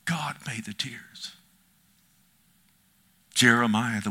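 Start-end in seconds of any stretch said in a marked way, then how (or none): 0.1-1.3 slow, soft, breathed; a hushed, reverent whisper. Acted. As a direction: drop to a whisper for God made the tears. Hushed statement after loud line.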